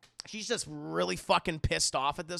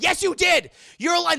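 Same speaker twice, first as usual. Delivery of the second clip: high, loud shout of accusation; shrill accusing yell; 12 semitones higher, 11 dB louder than usual. Retold or imitated. Mother shrieking that her daughter is lying. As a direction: scream it like a furious mother.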